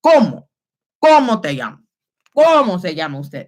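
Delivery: CÓMO leaned on hard, the rest dropping away; mock over-stressed learner's "cómo"; exaggerated accent stress. Shown as a demonstration. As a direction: overdo the stress at the start of cómo. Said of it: "no one that speaks Spanish is walking around".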